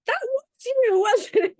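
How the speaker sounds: high, loud, excited retold question; excited realisation. Reading a friend's message about their meeting, excitedly. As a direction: exclaim it, pitched right up.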